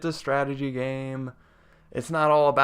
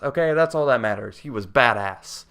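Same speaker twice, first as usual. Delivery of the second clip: ordinary talk, nothing marked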